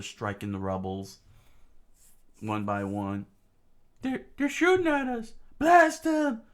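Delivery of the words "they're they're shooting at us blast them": very high, loud falsetto shouting; panicked battle yell. Acted. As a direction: yell orders under fire. Rebels under fire yelling.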